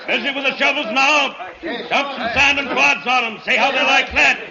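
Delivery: rally the men with urgent orders; shouting orders. Acted.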